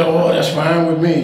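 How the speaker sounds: mock challenge, voice jumping up.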